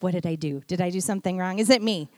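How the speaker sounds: low, quick mock-worried questions; a hint of a nervous husband; lightly voiced worry. Husband wondering if he caused tears, mildly worried. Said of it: "his immediate thing is like".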